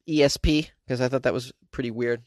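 normal talking voice

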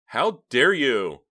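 loud, slow, high enraged cry; furious outburst. A narrator's reading. Justine bursting in on her uncle, furious. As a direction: spit each word in rage.

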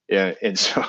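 breathy giggle breaking through words; trying not to laugh, failing; stifled giggles. Real, not acted. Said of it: "I was trying not to laugh".

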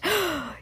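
short soft gasp in awe; soft high gasp. Acted. Witch sisters gasping at the lighter's flame, soft high gasp. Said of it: "the Sanderson sisters gasped".

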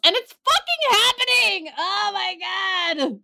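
high, alarmed cry; freaking out about the virus; panicked outburst. Real, not acted.

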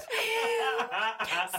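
high burst of laughter. Real, not acted. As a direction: crack up at your own voice. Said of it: "now I'm just laughing at myself".

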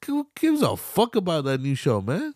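high, incredulous complaint; exasperated. Retold or imitated. Vlogger mocking the daughter's priorities, high exasperation. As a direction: toss the question off in disgust. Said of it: "I'm like".

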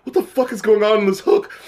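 usual speaking voice

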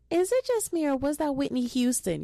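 high, loud imitation of a little girl's Southern accent. Imitating the Southern little girl in the viral video. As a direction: squeak it like a small Southern child. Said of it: "in the most southern grown accent".

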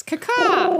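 high crow caw; chirping bird person. Pretend. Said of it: "we're only making bird noises".

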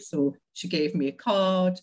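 ordinary talk

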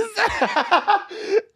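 real high-pitched burst of laughter; high loud laugh. Real, not acted. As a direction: crack up loudly at your own story. Cracking up at own story.

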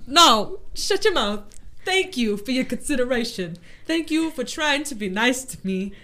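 loud, high mock meltdown; tearful outburst. Near-crier rebuffing a kind friend, tearful mock outburst. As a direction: snap loudly while close to tears.

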